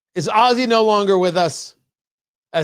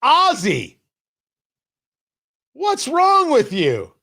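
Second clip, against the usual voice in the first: mock scolding; 9 semitones higher than usual. Host mock-scolding chat user Ozzy.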